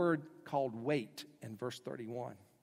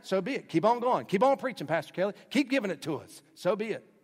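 higher, louder call of so be it; congregation urging on; 5 semitones higher, 5 dB louder than usual. Retold or imitated. Churchgoer urges Pastor Kelly to keep preaching, excited. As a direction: cheer the pastor to keep going. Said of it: "you're telling me just now".

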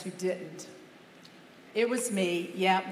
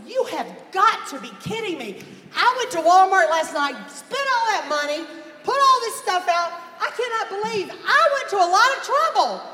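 louder and higher, piling up the complaint; indignant rant; 6 semitones higher, 6 dB louder than usual. Retold or imitated. Wife venting to the congregation about her wasted effort, loud and indignant. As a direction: vent it all, getting louder.

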